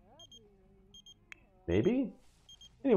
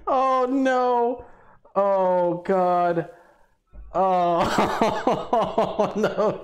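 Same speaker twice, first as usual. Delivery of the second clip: higher, laughing exclamations; laughing dismay; 6 semitones higher, much slower than usual. Real, not acted. Player laughing in mock dismay at Jace. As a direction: cry out between laughs. Reacting to Sim's misfortune.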